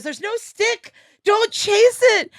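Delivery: high and sharp, the last words slow and loud; mock stern warning. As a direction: scold a dog-like friend. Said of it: "and he goes".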